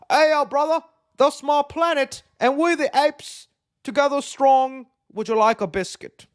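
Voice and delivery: high, loud mock British-flavoured ape accent with a biscuit offer; funny foreign ape accent. Imitating how apes abroad would talk, in a high, hammed-up foreign accent. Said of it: "they're gonna have accents and they're gonna talk funny".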